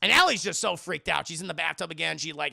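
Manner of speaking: ordinary talk, nothing marked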